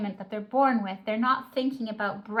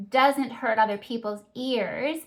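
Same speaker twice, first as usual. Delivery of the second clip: usual speaking voice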